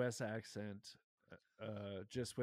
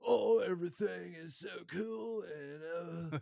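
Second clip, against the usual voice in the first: lazy laid-back California surfer voice, pitched higher and louder; surfer dude; 5 semitones higher, 4 dB louder, slower than usual. Retold or imitated. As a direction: ham up a chill surfer bro. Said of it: "like, a surfer dude".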